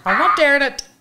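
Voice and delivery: laughing refusal; helpless laughter. Real, not acted. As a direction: protest while cracking up. Cracking up over her own accent.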